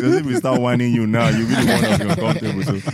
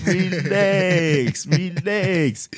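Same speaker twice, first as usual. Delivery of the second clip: mock calming; 9 semitones higher, much slower than usual. Calming an overexcited cohost.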